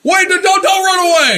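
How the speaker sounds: a husband shouting after his fleeing wife; pleading shout. Acted. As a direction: call after her not to run. George pleads as Mary flees.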